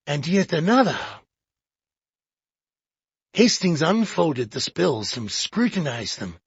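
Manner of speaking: ordinary delivery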